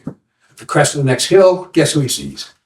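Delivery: usual speaking voice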